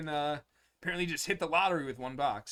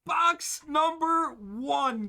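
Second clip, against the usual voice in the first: excited; 11 semitones higher, 6 dB louder, much slower than usual. Real, not acted. Opening first box, hyped.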